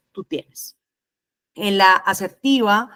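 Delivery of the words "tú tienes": very soft, submissive voice; passive. Shown as a demonstration. Teacher showing students passive communication, meek and soft. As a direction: give way, barely voicing it. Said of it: "la pasiva es".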